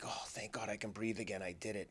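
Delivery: a quiet thank-God exhale; relieved sigh. Retold or imitated. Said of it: "a feeling of relief".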